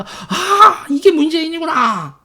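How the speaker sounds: high, loud aha; a sudden penny-drop cry; sudden realization. Retold or imitated. Remembering the moment it all clicked, high and loud. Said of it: "sudden realization".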